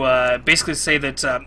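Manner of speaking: normal talking voice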